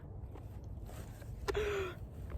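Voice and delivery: sharp breathy gasp in awe; a real gasp at a lovely surprise; long gasp of delight. Real, not acted. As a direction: catch your breath, amazed. Sees Blue Angel deck.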